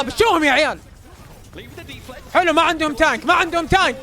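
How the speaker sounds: ordinary talk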